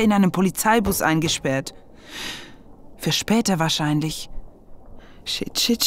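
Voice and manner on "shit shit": panicked whisper. Acted. Trapped, panicked cursing under breath.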